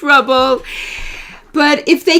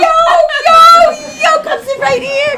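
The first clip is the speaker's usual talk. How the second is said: high, whooping call; real excited welcome; 13 semitones higher, 4 dB louder than usual. Real, not acted. Teacher waving a friend to a seat, excited whooping. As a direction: whoop them in with joy. Greets arriving people at class.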